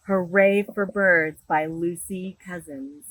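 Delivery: bright, lively storybook read-aloud; animated picture-book reading; reading to children. Acted. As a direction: read it big for a room of little kids. Librarian reads picture book aloud.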